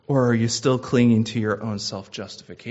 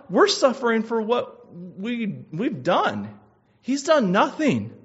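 thief's rebuke; 7 semitones higher, 3 dB louder than usual. Acted. Dying thief rebukes the mocking thief, loud. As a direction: cry it out at him like a dying man.

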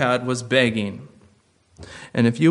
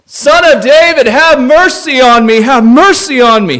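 much higher, loud desperate cry for mercy; desperate scream; 13 semitones higher, 15 dB louder than usual. Acted. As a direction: scream it out like a blind beggar begging Jesus. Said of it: "he shouted all the more".

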